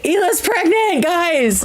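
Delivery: high excited announcement. Real, not acted. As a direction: squeal the news out.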